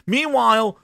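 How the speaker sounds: ordinary talk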